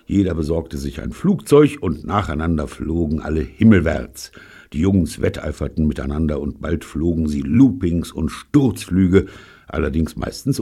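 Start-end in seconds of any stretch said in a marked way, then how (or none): none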